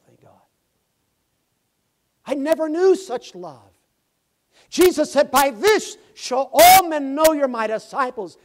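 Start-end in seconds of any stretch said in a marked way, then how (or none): 2.3-3.8 much higher and louder, ringing out; preaching shout. Acted. Preacher calling the line out to the church, loud. 4.7-8.4 much higher and louder, ringing; full-force preaching. Acted. Preacher quoting Jesus to the church at full force.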